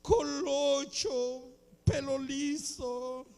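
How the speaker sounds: soft, drawn-out whimper of an abandoned wife; mock tearful pining. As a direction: coo pet names as if weeping, hammed-up. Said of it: "llorando y viendo la foto del marido". (lloriqueo fingido, cariñoso)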